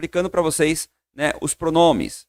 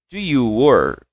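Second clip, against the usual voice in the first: model phrase; 3 semitones lower, much slower than usual. Shown as a demonstration. Teacher models the question for students to repeat, clear and even. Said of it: "repete comigo".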